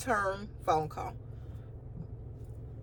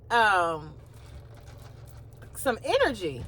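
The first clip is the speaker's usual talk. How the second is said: sassy aside; 7 semitones higher than usual. Retold or imitated. Sassy aside about receptionist.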